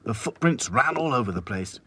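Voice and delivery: ordinary delivery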